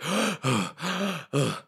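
repeated mock gasps; acted desperate panting; gasping for air. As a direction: heave for breath after being held under. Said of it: "he's just gasping for air".

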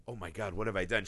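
low, soft dawning doubt; understated guilty murmur to oneself; subtle worry. Retold or imitated. Imitating the daughter doubting what she saw, subtle low worry. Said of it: "Just this kind of subtle".

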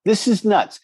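retelling his awe at the arm wrestler's strength; amazed disbelief. Retold or imitated. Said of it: "I went".

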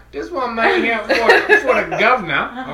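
slow, laughing, hammed-up British accent; mock British accent. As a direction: play a pompous Brit addressing the governor. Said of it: "hillbilly Australian British".

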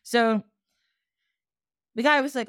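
ordinary talk

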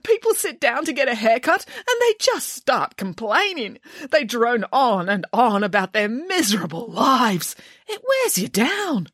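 high, self-pitying hairdresser; hammed-up weepy grievance; tearful complaint. A narrator's reading. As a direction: wail about the job, slowing toward the end. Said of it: "sniffed the hairdresser".

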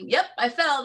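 high, upbeat owning-up; laughing it off. Acted. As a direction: own the tumble with a high, light voice. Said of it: "laugh at yourself".

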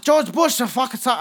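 failed attempt at an Arabic accent. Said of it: "I can't do".

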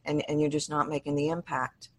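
soft and rushed, shrinking away; small quiet voice. Shown as a demonstration. Voice coach showing the host a quiet, rushed voice. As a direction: mumble it fast and small, losing impact. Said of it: "you're talking like this".

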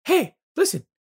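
very high, piping nagging calls; Navi. Retold or imitated. Fairy interrupts player fighting a spider, shrill-pitched nagging. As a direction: mimic a pestering fairy, high and insistent. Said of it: "Navi's kinda, like, the most famous".

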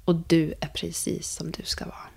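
whispered affirmation. Shown as a demonstration. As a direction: whisper it like a kind reassurance. Gentle affirmation to student.

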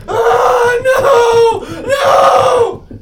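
very high, loud terrified scream; drawn-out shrieked cries. A narrator's reading. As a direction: scream in terror as you're attacked. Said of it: "David screaming".

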